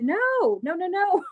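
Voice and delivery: high, apologetic denial; retold flustered protest. Speaker insists to the angry lady she go first, flustered.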